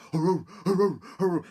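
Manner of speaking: high, loud baying bark; bay as you chase; dog barking. Acted. Dog chasing the gingerbread man, loud high baying. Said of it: "dog bounding and barking".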